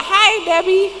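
high, bright call back from the field; shouted greeting. Retold or imitated. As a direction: answer the call across the lot, high. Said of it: "And she would shout back".

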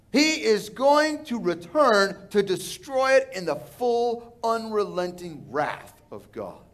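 normal talking voice

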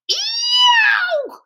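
very high, loud scalded yowl; storybook yelp of sudden pain; wolf's scream. A narrator's reading. Wolf lands in boiling turnips and screams. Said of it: "leaped up with a scream".

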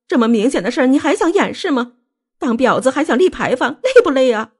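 high-pitched furious tirade; shrill, indignant outburst; angry shouting. A narrator's reading. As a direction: hurl accusations in a high, incensed voice. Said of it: "索性喊了起来".

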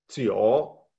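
"chio" dipping then rising; Mandarin jiǔ. Shown as a demonstration. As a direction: say "chio" like Chinese nine. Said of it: "se pronuncia chio".

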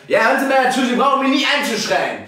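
höher und lauter, wütend anschreien; angry shout. Real, not acted. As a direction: schrei sie empört nieder. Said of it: "Sie müssen nicht schreien in mich drinnen". (lauter, wütender Schrei im Treppenhaus)

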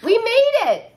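high, loud cheer; exclaiming. Acted. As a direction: burst out in triumph. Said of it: "Ava exclaimed".

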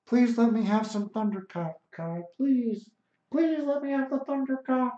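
high pleading whine that keeps climbing; mock pleading whine. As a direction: beg for it in a high mocking voice. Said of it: "He's like".

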